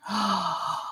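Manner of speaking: soft, drawn-in gasp. Real, not acted. Pleading with friend not to leave, soft gasp. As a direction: gasp softly, well under speaking level.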